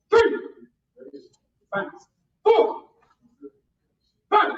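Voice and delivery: four barked high and loud; shouted count. Shown as a demonstration. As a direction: fire the count like a starting gun. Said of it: "When you hear my voice, bang".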